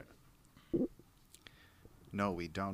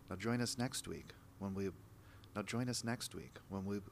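smooth show-host sign-off; TV host outro; 5 dB quieter than usual. Acted. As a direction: read it like a gentle TV host. Host outro, retaken several times.

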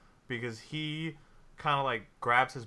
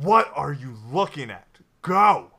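angry yell; 3 semitones higher, 12 dB louder than usual. Acted. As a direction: bark at the frozen crowd. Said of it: "turn around to everyone and go".

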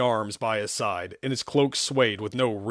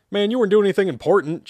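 quick, higher, louder comeback; fast retort; 8 semitones higher, 5 dB louder, faster than usual. Acted. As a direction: snap back at a friend fast.

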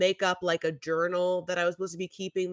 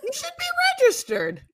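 very high, louder squeaky mock scold on "be"; 6 dB louder than usual. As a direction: squeal "You should be registered." way up high. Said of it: "They're just kind of like".

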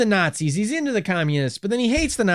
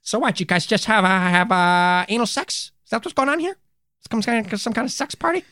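higher, prissy cartoon voice; sitcom god voice; 5 semitones higher than usual. Host voicing a god who stumbles on the ritual, higher. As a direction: ask it like a scandalized cartoon.

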